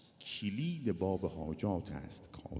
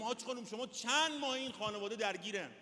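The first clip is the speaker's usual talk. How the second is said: pleading question. Retold or imitated. Doctor pleading with mother.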